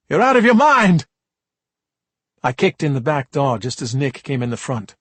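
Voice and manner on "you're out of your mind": much higher, louder angry shout; furious yell. Acted. Father yells at raving son, furious shout.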